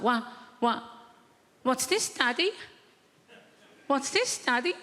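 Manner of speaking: put-on high, curious toddler voice; little girl voice. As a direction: ask like an inquisitive toddler. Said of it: "She's like".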